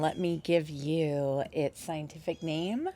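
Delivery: ordinary delivery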